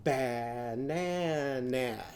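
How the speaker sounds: "Banana" slowly with every vowel as a short a. Shown as a demonstration. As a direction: stretch "Banana" into ba-na-na with flat a. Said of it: "Show me adding".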